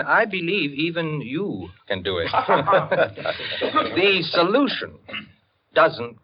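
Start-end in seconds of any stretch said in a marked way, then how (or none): 2.3-3.9 amused chuckle. Acted. Teasing Rankin he can do it.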